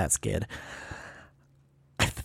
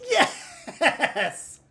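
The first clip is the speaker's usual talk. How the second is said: high, drawn-out yes with a laugh; triumphant yes; 14 semitones higher, 4 dB quieter than usual. Real, not acted. Player celebrating a Hail Mary guess, triumphant. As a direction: crow a much higher, elated yes. Won with a last-chance guess.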